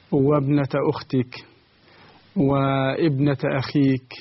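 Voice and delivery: ordinary talk, nothing marked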